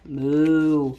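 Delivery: low, drawn-out moo; cow moo. Acted. Teacher voicing the typing cows, low moo.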